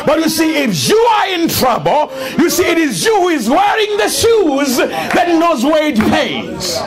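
fervent shouted sermon; rousing pulpit shout; preaching at full force. Acted. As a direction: preach it to the rafters.

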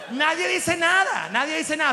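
nobody says a word, called out high; emphatic shout. Real, not acted. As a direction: belt it out with a laugh. Punchline shouted.

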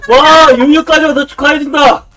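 normal talking voice

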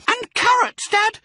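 very high, loud little voice; smallest fox cub. A narrator's reading. Smallest fox pipes up to Dad, eagerly. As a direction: pipe up like a small child. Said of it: "said the smallest of the three small foxes".

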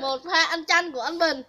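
excited announcing. Real, not acted. Announcing eating lime for camera.

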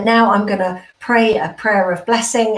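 normal talking voice

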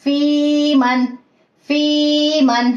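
फी held long before मन, very slowly; drawn-out madd. Shown as a demonstration. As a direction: stretch फी for two counts. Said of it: "वही कायदा इस या में भी आ रहा है".

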